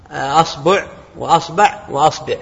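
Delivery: "أصبع وأصبع وأصبع" slowly, with a fatha on the hamza and the vowel on the ba changing each time; pronouncing the variants. Shown as a demonstration. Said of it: "فتقول". (نطق أصبع بفتح الهمزة مع تغيير حركة الباء ببطء)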